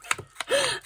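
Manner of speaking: a squeaky burst of laughter; real high giggle. Real, not acted. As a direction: laugh at mum's antics. Laughing at mum.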